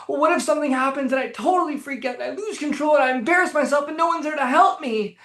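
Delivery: high, fast panicky worrying; mock anxious. Anxious person's inner what-if spiral, mock anxious. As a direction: spiral through what-ifs in a put-on anxious voice. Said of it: "they're doing in this tone of voice".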